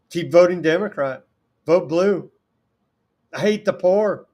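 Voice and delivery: sarcastic slogans. Retold or imitated. Host mocking voters with sarcastic rally slogans.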